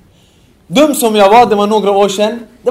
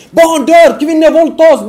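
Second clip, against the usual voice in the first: mock protest shout; 9 semitones higher, 9 dB louder, slower than usual. Preacher echoing the slogans shouted at them. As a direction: yell the slogans, much higher and louder. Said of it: "det de skriker på oss över".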